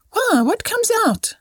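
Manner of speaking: put-on very high, wide-eyed little child; small child's amazed question; wondering. A narrator's reading. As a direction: ask in wonder, pitch soaring. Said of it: "said Little Peace with wondering eyes".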